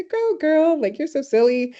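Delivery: high, playful teasing cheer; excited praise. Retold or imitated. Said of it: "listening to her and say".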